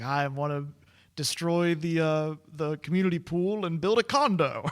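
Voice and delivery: lightly voiced greedy corporate baddie; mock villain boast. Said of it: "classic nineties corporate villain".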